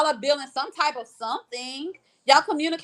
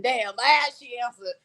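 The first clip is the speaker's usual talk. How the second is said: normal talking voice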